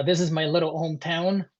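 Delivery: ordinary delivery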